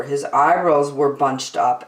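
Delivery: usual speaking voice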